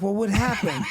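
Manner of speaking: high mock-innocent fright with a laugh; looking scared. Shown as a demonstration. Driver fakes fear to the homeowner, laughing. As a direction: act scared and clueless about the damage. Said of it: "I'll just look really scared".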